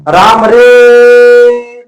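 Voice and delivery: राम रे called loud and high with रे held very long; calling from afar. Shown as a demonstration. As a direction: hail someone far off, stretching रे. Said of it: "किसी दूर स्थित व्यक्ति को बुला रहा है". (दूर खड़े व्यक्ति को ज़ोर से पुकारना)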